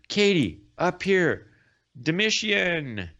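high, ringing call to someone; acted shout from above. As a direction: call from afar, stretching the last word. A voice calls out of the blue.

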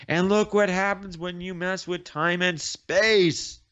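mock outraged lecture; acted scolding. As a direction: build to a big finish on the last word. Kate scolds in acted quarrel.

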